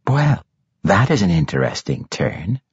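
usual speaking voice

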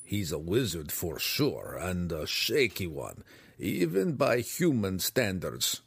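slow, distinct gnome voice, wary; whispered gnome aside. Acted. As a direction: size up the stranger in a guarded, drawn-out aside. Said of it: "Belwar whispered".

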